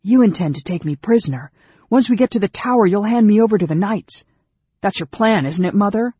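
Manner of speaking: loud, high-pitched outburst; angry accusation. A narrator's reading. Angry son accuses his mother.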